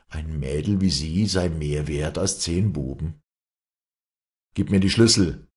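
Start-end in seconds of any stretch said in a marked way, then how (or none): 4.5-5.5 loud, firm, higher-pitched order; strong command. Acted. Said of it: "befahl sie mit starker Stimme". (mit starker Stimme befohlen)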